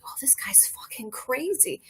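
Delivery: hushed disbelieving aside; whispered thought. Retold or imitated. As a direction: mutter it under your breath like a secret.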